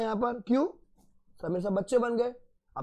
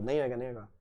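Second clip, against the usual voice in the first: mocking taunt; 7 semitones lower, 6 dB quieter, much faster than usual. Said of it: "कैसे करके दोनों group".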